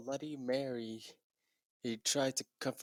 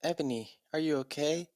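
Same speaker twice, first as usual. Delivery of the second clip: higher, a bit worried asking; lightly voiced concerned friend; 6 semitones higher, 3 dB louder than usual. Bloody Mary asking Ebony if she's okay, mild concern. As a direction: ask gently with mild concern. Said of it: "a Bloody Mary asked".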